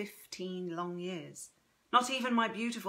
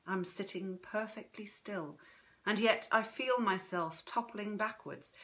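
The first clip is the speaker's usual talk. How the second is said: usual speaking voice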